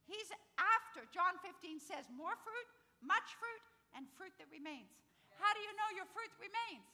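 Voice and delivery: high, loud preaching in a rising run; impassioned preaching. Real, not acted. Preacher urging the church to bear fruit, impassioned. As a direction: preach it hard and keep it rolling.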